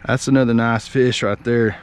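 usual speaking voice

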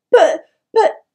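high, loud, flustered stammer. A narrator's reading. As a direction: trip over the words in panic. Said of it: "Twig stammered".

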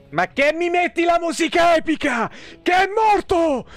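ordinary talk

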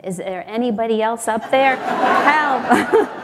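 yelling. Retold or imitated. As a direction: call out for any other rescuer. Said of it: "you hear Jack yelling again".

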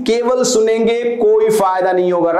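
ordinary delivery

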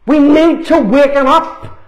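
full-force sermon delivery; fiery preaching. Acted. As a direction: preach it hard, warning the congregation. Preaching against demons in church.

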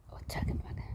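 soft whisper to a pet. Real, not acted. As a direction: whisper it gently to the bird. Hushed aside to the bird.

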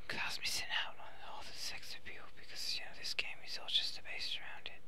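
soft unvoiced whisper; playful stealthy whisper. Real, not acted. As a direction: whisper so the guards won't hear. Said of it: "whispering to stay stealthy".

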